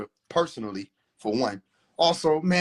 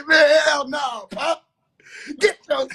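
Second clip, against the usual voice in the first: outraged yell; 11 semitones higher, much slower than usual. Real, not acted. Outraged reaction to dad.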